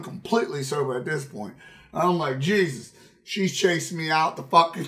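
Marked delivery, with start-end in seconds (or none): none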